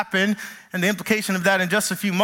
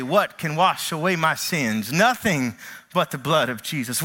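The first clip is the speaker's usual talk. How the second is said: psalmist's hymn; 5 semitones lower than usual. Acted. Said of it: "I hear the psalmist say".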